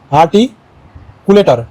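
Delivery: "আর্টিকুলেটর" very slowly, with a pause inside; slow, split word. Shown as a demonstration. Teacher introduces the next practice word, "আর্টিকুলেটর" slow and split. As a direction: draw out "আর্টিকুলেটর" in separated chunks.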